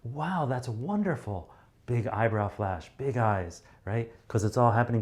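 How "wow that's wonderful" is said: higher, slow, delighted; exaggerated delight; overjoyed. Shown as a demonstration. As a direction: gush with happiness for them. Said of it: "you are more than happy".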